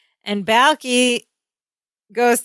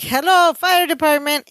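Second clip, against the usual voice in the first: higher, lightly put-on Balki accent; lightly voiced Balki answering the fire phone; Balki's accent; 5 semitones higher than usual. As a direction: answer the phone like a foreign fireman. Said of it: "in his accent".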